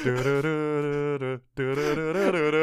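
slow sung-out phone ringtone, du du; imitated ringtone. Imitating the ringtone of an Egyptian phone line. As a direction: mimic a foreign phone ring slowly. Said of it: "the ringtone goes off".

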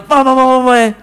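high, rapid nagging babble; mock a girlfriend nagging on and on.

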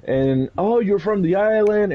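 high, squealing excited voice; imitating the VTuber's high excited voice; excited girl. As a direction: mimic her delighted high squeal. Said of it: "She was like".

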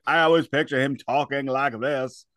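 put-on glamorous old Hollywood star voice; mid-Atlantic accent. Shown as a demonstration. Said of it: "has a mid-Atlantic accent".

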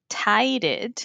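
"tired" as two syllables, "tai-red"; spelling pronunciation. Shown as a demonstration. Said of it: "Se escribe". ("tired" leído como se escribe, "tai-red")

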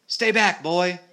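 high, loud warning; shouting. A narrator's reading. Alf warning the boy off the monster, shouting. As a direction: shout an urgent order to keep away. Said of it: "shouted Alf".

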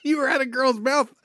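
mock-shocked double take; incredulous repeat. Real, not acted. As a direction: ask it again, not believing your ears. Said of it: "That's what it sounded like you just said".

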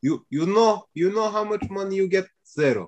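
menacing mock Russian mobster accent. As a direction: play a Russian gangster quoting the money. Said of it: "you said it like a Russian mobster".

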